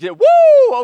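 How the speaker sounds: a sudden high, loud woo; excited whoop. Real, not acted. Preacher carried away mid-sermon, high loud whoop. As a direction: let the excitement burst out.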